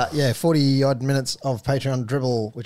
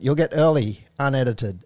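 mock Irish; 2 semitones higher, 5 dB louder than usual. Host reading the Patreon plug in mock Irish. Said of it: "I've gotta stop it".

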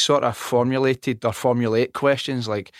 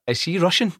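higher-pitched amused puzzlement; retold bafflement; 7 semitones higher than usual. Recalling first hearing a strong accent, baffled. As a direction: ask it as if baffled.

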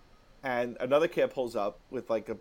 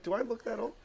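mock hurt; 10 semitones higher, 5 dB quieter, faster than usual. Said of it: "I'm like".

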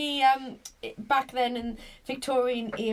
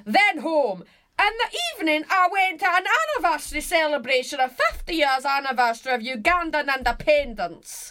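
put-on loud, higher Glasgow accent, reading out; hammed-up Glasgow accent, high and emphatic; granddad's Glasgow accent; 4 semitones higher, 4 dB louder than usual. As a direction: read it as a Glaswegian granddad. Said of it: "do it in his accent".

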